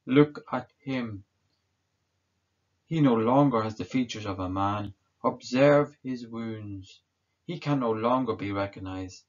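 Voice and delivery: a governor urging the crowd to release him; Pilate addressing the crowd. Acted. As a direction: present the prisoner to the mob, half pleading. Pilate presents Jesus to crowd.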